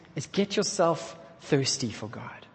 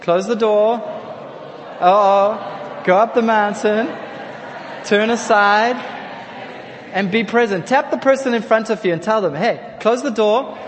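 loud, high, rousing chant; rousing call; 5 semitones higher, 6 dB louder than usual. Shown as a demonstration. Preacher rousing the congregation to shout the phrase together. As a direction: drive it home to the whole room. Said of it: "Look at the person behind you".

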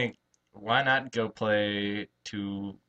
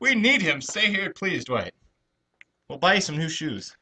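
high, pleading plea to a star; mock pleading; 6 semitones higher, 4 dB louder than usual. Fan pleading with Dwight Howard to stay, high and begging. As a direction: beg him to stay, voice raised high.

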